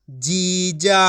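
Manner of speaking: जीजा with both vowels held; drawn-out long vowels. Shown as a demonstration. Teacher models the joined word, vowels drawn out. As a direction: stretch जीजा out syllable by syllable. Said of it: "खींच के पढ़ेंगे".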